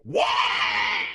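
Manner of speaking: loud, breathy shouted wah; a whole horde letting rip at once; screaming war cry. A narrator's reading. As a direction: orc horde screaming in madness. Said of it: "Every orc on the continent screams".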